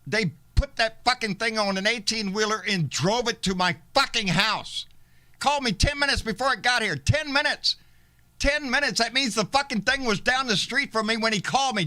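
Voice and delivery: loud furious ranting; angry rant. Real, not acted. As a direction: yell it out in outrage.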